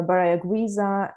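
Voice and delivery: ordinary talk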